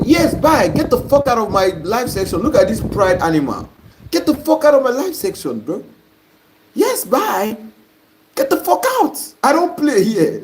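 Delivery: high-pitched, indignant scolding; real irritated telling-off; angry dismissal. Real, not acted. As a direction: throw the heckler out, pitch jumping high. Host throws a heckler out.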